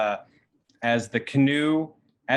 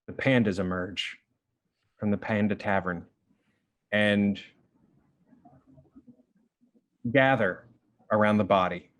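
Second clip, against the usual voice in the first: hushed, lower narration with long pauses; grave, measured game master narration; solemn scene setting; 3 semitones lower than usual. A narrator's reading. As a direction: let each phrase settle before the next.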